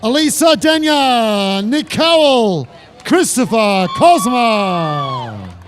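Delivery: slow, drawn-out finish-line name call; announcer name call. Real, not acted. Announcer to finishers crossing the line, drawn-out name call. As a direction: stretch each surname out long.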